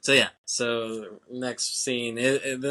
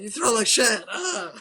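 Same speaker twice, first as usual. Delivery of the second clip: high, hammed-up outburst; retold yell ending in a cry; mock angry yell; 10 semitones higher than usual. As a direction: imitate a fed-up man yelling at a kid. Retold insult yelled at kid.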